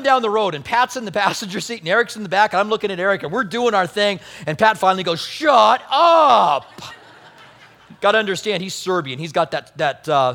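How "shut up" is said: loud, higher, gruff ogre-style shout; Shrek-like shout. Retold or imitated. Pat in the car telling the mimicking friends to stop, ogre-style shout. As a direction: bark it like an annoyed ogre. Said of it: "Shrek thing going on".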